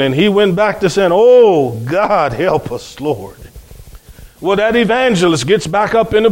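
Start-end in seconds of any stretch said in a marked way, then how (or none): 1.1-3.4 slow groaning plea that fades right down; groaning prayer. Retold or imitated. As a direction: groan it out to God, trailing off. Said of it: "lay up under this chair and groan".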